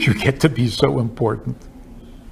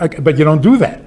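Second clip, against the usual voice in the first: ordinary talk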